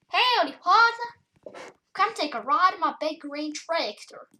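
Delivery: playful farm-country accent. Shown as a demonstration. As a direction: put on a farmer's howdy accent. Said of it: "That's a farm accent".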